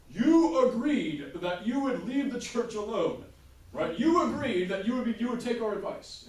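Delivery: high, loud, slow indignant pope; papal voice. Retold or imitated. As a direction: scold the king like a wronged pope. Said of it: "being like".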